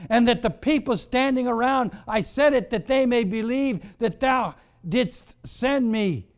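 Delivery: ringing, urgent sermon reading; dramatic preaching. Real, not acted. Declaims the story at full force.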